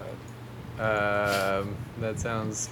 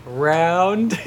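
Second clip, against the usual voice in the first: incredulous; 9 semitones higher, 7 dB louder than usual. Real, not acted. Incredulous again, laughing.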